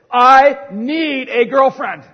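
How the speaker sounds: put-on loud, slow, high cry of teenage longing; desperate plea. Preacher acting out his teenage self, a loud slow desperate plea. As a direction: wail it out like a lovesick teen.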